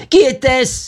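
very high alarmed demand; frantic woman's outcry; crying out. A narrator's reading. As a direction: cry it out in high-pitched panic. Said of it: "s'écria encore Vera".

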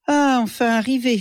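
exclaimed. A narrator's reading. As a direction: read it as a relieved arrival exclamation. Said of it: "s'exclama le père Thomas". (exclamation légère)

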